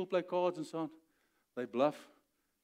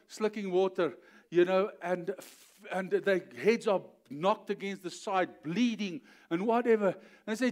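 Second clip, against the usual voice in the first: ordinary delivery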